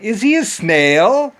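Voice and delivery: slow, high incredulous ask; slow high mock wonder; mocking question. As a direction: stretch it out in disbelief. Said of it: "I was like".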